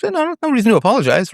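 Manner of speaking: breezy high reassurance, rushed; reassuring, high and quick. Retold or imitated. Man reassures the apologizing woman, high and brisk. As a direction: wave off her apology lightly and fast. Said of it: "and like".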